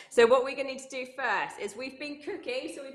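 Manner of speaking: energetic fitness instructor calling out moves and counts; upbeat workout coach. Acted. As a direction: pump the kids up, keep them moving. Leads a kids' workout.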